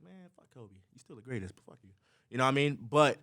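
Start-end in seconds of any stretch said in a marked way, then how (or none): 0.0-1.9 very soft muttered aside; imagined grumble. Retold or imitated. Fan grumbling to himself about idol, hushed mock grudge. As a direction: let it drop almost to nothing.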